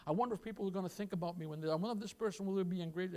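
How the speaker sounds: soft, rushed private thoughts; inner whisper. Acted. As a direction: murmur it fast like a secret worry. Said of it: "your fallen heart is whispering to you".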